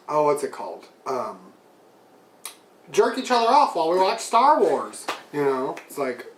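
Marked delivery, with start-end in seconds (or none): 0.1-5.1 mock nerd voice. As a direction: play the excited fanboy boyfriend. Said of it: "the nerd ... is like".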